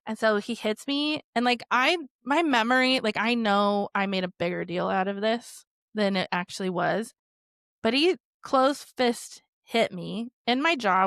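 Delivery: normal talking voice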